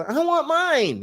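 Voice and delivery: high, stretched-out pouty whine; whiny voice. Pretend. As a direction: whine like a sulking kid who wants his toy. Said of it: "that's my whiny voice because I'm whining".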